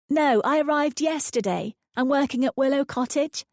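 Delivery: eager, explaining herself; Lucy's voice. A narrator's reading. Lucy explaining her job to the neighbour, light.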